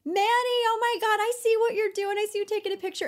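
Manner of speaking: high, excited star voice calling out; shrieking excitedly across to him from her car. Retold or imitated. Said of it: "she was basically just like".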